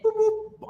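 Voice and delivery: high beeping imitation of call waiting; imitated phone beep. Narrator mimics the call-waiting beep on his phone, high chirp.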